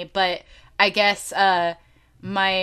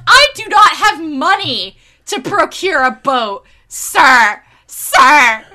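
exasperated protest; 5 semitones higher, 9 dB louder, slower than usual. Retold or imitated. Exasperated reply to quest giver.

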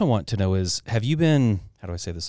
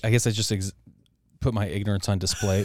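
ordinary talk

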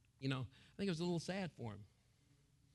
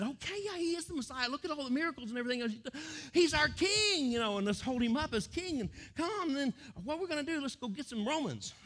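high, eager crowd voice; rally everyone, all fired up; excited crowd; 8 semitones higher, 4 dB louder than usual. Acted. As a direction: crowd deciding to make him king.

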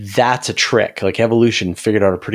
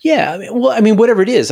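excited; 14 semitones higher, 5 dB louder, faster than usual. Real, not acted. Excited burst of agreement.

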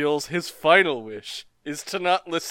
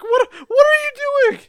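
high, loud panicked squeal; imitating Polnareff's horrified shriek; mock terror; 7 dB louder, faster than usual. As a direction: shriek it in disbelieving horror. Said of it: "the dejected terror".

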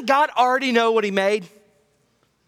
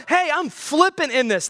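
flippant mock; 7 semitones higher than usual.

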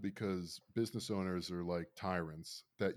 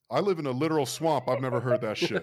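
loud, raised, fast protest; mock appalled disbelief; 4 semitones higher, 10 dB louder, faster than usual. Louisianans told about Florida slurs, loud mock disbelief. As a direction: protest loudly, imitating shocked locals.

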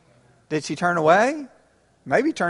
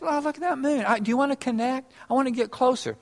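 lightly voiced wife, a touch higher and softer; a mild hint of a wife hoping to connect; wistful Sarah; 6 semitones higher, 6 dB quieter, faster than usual. Pastor voicing Sarah wishing her husband would look up, gently pleading. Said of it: "Sarah doesn't care about the moon. Just".

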